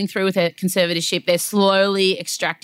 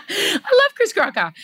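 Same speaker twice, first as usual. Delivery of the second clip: laughing; 11 semitones higher than usual. Real, not acted. Laughing declaration.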